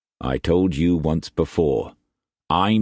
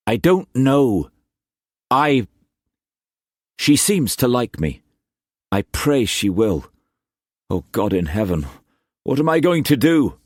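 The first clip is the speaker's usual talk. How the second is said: put-on higher, urgent young man's voice; anxious young Cullum; 6 semitones higher than usual. Cullum pleading his love to Struan, higher and urgent. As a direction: plead like a lovesick youth.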